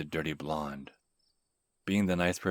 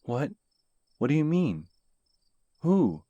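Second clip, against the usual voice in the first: alarmed; 11 semitones higher, 10 dB louder than usual. Acted. Alarmed stranger demanding answers.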